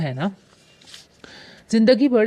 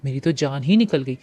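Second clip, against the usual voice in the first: plain reading voice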